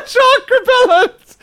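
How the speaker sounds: squeaking through high laughter; laughing. Real, not acted. Laughing at the joke.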